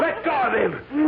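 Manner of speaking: slow, high shouted order. Acted. Phil shouting at Sylvia during the struggle. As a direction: yell it while struggling.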